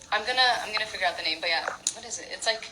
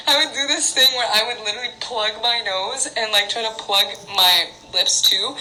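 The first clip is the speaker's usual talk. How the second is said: ordinary delivery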